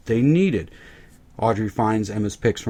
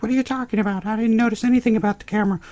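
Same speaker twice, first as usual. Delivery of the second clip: high mock-girly falsetto; clueless question in a hammed-up squeal; quick, high-pitched mock girl voice; imitating the girls; 12 semitones higher, faster than usual. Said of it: "The girls are like".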